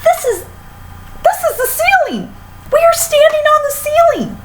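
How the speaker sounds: very high frantic shrieking; very high, frantic hysterical wife; panicked screaming. A narrator's reading. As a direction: shriek in horror at the ceiling. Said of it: "screamed Missus Twit".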